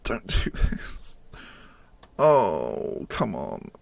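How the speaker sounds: slow, breathy whispered begging; barely voiced, pleading with the game; whispered plea. Real, not acted. Desperate final minutes, pleading.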